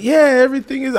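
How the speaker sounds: high startled yeah; flustered reply. Retold or imitated. Answering the unseen wife, high and startled. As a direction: caught off guard, voice jumps up. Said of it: "And I was like".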